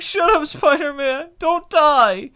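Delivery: high, slow mock pleading; dramatic moviegoer. Mimicking the guy begging Spider-Man not to die, dramatic. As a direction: plead in a hammed-up wail. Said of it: "at this dramatic moment".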